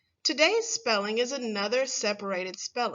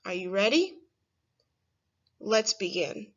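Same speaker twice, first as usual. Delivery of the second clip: ordinary delivery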